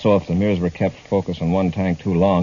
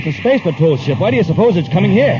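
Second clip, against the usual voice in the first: high, louder panicked cry; alarmed shout; 10 semitones higher, 6 dB louder than usual. Acted. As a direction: yell it out in rising alarm. Alarmed at patrol ship arriving.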